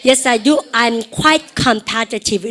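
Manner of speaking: slow, words drawn out at the end; slow answer for students to repeat; slow drill. Shown as a demonstration. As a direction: model the answer slowly. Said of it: "시작".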